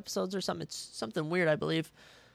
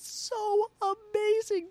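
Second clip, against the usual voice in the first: excited; much slower than usual. Real, not acted. Gushing over Game of Thrones.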